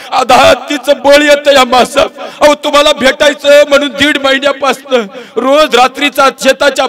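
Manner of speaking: high, choked voice on the edge of crying; tearful plea. Acted. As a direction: pour it out through tears, pleading. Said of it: "खळखळणाऱ्या डोळ्यांनी ते पोरगं उत्तरलं". (डोळ्यात पाणी आणून, रडवेल्या आवाजात विनवणी)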